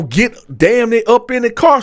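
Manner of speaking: ordinary talk, nothing marked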